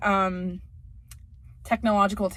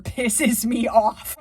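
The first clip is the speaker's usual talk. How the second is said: normal talking voice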